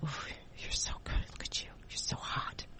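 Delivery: breathy flirting whisper, barely voiced. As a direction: whisper it like a guy leaning in close. Guy leaning in at event.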